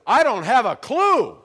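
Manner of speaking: high, loud, slowed-down mock yell; bewildered shout. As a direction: act out a friend's stunned, hollered reply. Retelling friend's equally stunned answer.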